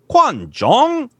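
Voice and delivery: high, loud, sing-song QUAN ZHONG; imitated Chinese recording. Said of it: "saying them to me in Chinese".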